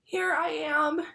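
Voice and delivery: high, very slow call from far off; faint faraway call. A narrator's reading. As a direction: call back weakly from inside the shade. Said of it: "called back".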